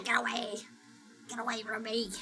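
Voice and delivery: ordinary delivery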